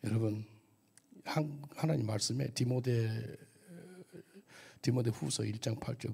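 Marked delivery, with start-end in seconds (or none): none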